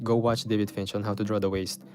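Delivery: ordinary talk, nothing marked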